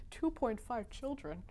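soft laughing disbelief. Real, not acted. Narrator amused by the author's joke, laughing. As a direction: laugh through the question.

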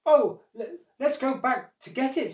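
high, loud flustered suggestion; eager crocodile. A narrator's reading. Crocodile falling for monkey's trick, eager. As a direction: stumble eagerly into the idea.

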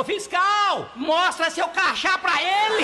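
high, loud, slow taunting call; mocking shout. Farmer on the fence yells taunt at fleeing inspector, slow mocking shout. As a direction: drag it out to tease him from the fence.

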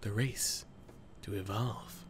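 slow, grand game intro read; trailer narration. Acted. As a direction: read it like an epic opening narrator. Reads the game's intro text.

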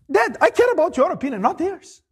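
earnest son to father. Acted. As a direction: say it like a devoted son.

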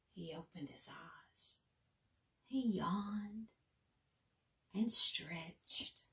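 soft whispered reading; hushed narration. A narrator's reading. Narrator whispering as Bird wakes, hushed bedtime reading. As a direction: read it like a bedtime hush.

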